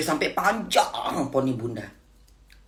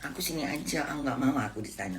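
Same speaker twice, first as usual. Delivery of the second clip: soft, softer sulky pleading; spoiled whiny voice; 2 semitones lower, 8 dB quieter than usual. Retold or imitated. Mother imitating her spoiled refusal to the boss. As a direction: sulk like a spoiled child, softly. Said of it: "ngomongnya kayak manja-manja gitu".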